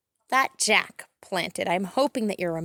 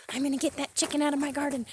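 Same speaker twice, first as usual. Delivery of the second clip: ordinary delivery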